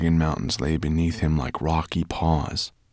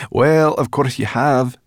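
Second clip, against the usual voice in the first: loud, drawn-out mock agreement; slow, high, loud sarcasm; sarcastic; 6 semitones higher, 6 dB louder than usual. A narrator's reading. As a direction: stretch it out mockingly. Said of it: "said Sorrel sarcastically".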